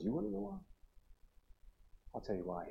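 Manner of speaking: grave confiding. Retold or imitated. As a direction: lead quietly into a painful secret. Old man begins painful confession.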